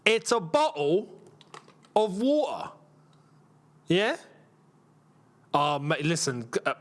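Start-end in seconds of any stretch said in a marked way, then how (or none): 0.0-2.7 slow "bottle of water" with glottal stops; deliberate British "bottle" and "water", t's swallowed; British pronunciation. Shown as a demonstration. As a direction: spell out "bottle of water" slowly, dropping the t's. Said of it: "the way we say water".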